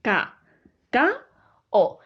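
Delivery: ca-ca-o con una pausa tras cada sílaba; separado en sílabas. Shown as a demonstration. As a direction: di ca, ca, o como tres sílabas sueltas. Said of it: "forman parte de sílabas diferentes". (ca-ca-o silabeado lento, la o aparte)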